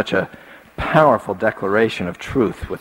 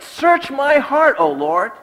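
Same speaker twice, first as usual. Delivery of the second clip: raised, pleading preacher's prayer; fervent psalm prayer; 14 semitones higher than usual. Acted. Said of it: "we ought pray, as the psalmist prayed".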